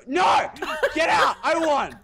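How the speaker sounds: high, loud victory yells; triumphant shouting. Real, not acted. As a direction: shout in disbelief at winning. Winning the game.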